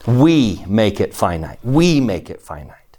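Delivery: emphatic, repeated point leaning on "We"; stress on WE. Real, not acted. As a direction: hit "We" hard, then let the rest fall away. Teacher stresses the student's point.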